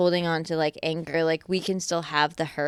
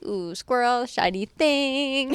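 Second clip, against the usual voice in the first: mock distraction; 6 semitones higher, much slower than usual. Mocking distracted attention.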